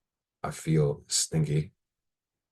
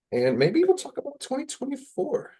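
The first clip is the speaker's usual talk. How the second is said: high squeaky voice. Real, not acted.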